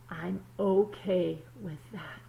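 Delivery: put-on calm storybook reading to kids; story narration. A narrator's reading. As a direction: read it like a picture book at story time. Reads the story aloud.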